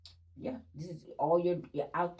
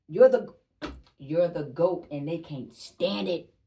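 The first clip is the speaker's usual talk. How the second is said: loud hype; 10 dB louder, slower than usual. Real, not acted. Hyping the viewer up.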